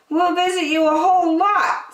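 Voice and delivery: put-on high girl's voice; sweet little girl promising; Betty. A narrator's reading.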